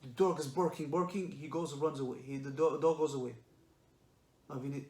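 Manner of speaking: usual speaking voice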